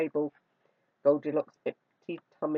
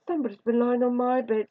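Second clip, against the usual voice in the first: higher, middle-sized bear voice; mellow Mother Bear; 6 semitones higher than usual. A narrator's reading. Mother Bear finding her bed disturbed, mellow. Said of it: "said Mother Bear".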